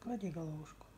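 slow, hushed cooing. Real, not acted. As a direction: coo softly to a sleepy pet. Cooing to the drowsy pet.

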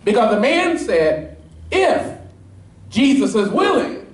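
high, ringing preacher's climb, pressing each point; preaching at full force. Acted. Pastor pressing the congregation on the leper's "If", fervent preaching. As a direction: drive the point home to the congregation.

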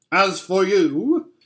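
imperious witch, high and loud; menacing witch. A narrator's reading. Witch turns on Edmund.

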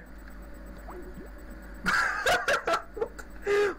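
long, wheezy helpless laugh; real laughing fit. Real, not acted. Prankster breaking character over own bad accent, helpless laugh. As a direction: crack up uncontrollably.

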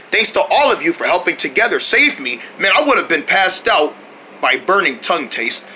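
ordinary talk

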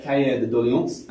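Cahier de Doléances in a French accent, kʰeə ðə dɔliɑ̃ns; French-accented Cahier de Doléances with nasal ending. Shown as a demonstration. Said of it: "I do apologise for my French accent".

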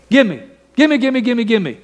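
high, sing-song gimme chant; mock greedy child chanting gimme; mock childish begging. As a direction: mimic a greedy kid. Mocking prayer as ATM.

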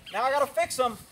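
normal talking voice

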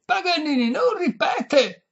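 high, loud, slow yell. A narrator's reading. Violinist leaning over lady's ear, high loud yell. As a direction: roar it slowly into a deaf ear. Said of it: "urlò il violinista".

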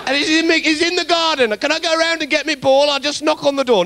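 high whining plea; a child begging for his ball back; childish whine. Pretend.

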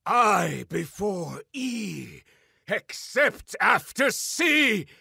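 slow chant building to a roar; entranced chant rising to a shout; mad scientist. Acted. Possessed by stolen knowledge, entranced.

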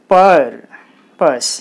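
పర్ long and forceful, పస్ short; stress on first syllable. Shown as a demonstration. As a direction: lean on పర్, pause, then a brief పస్. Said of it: "పర్ అన్నప్పుడు highlight అవుతుంది".

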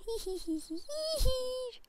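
high, slow mischievous giggle; sneaky giggle. Acted. Gamer after cutting in line, high gloating giggle. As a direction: giggle high like a little imp.

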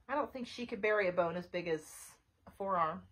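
plain reading voice

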